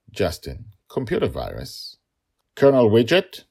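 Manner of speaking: plain reading voice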